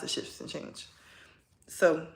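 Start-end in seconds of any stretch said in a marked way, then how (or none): 0.0-0.9 a hushed echo for emphasis; whispered repeat. Real, not acted. Tarot reader whispering the prediction again to viewers. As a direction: whisper the phrase back softly.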